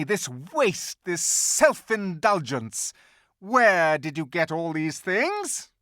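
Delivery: high, loud, scornful tirade, slowing; furious witch queen. Acted. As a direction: scold them with icy contempt. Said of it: "she said again".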